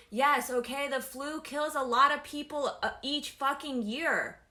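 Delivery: loud angry rant. Real, not acted. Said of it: "if I sound angry".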